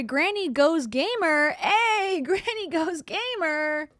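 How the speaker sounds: slow, loud, drawn-out announcement; announcing a name. Real, not acted. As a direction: call the name out. Reading donor name out.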